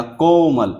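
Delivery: Komal with the को pulled long; stretched vowel. Shown as a demonstration. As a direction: draw out the O in Komal slowly. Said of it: "तो खींचना पड़ेगा".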